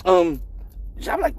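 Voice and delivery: ordinary talk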